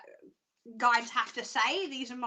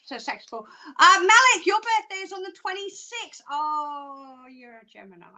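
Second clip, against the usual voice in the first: normal talking voice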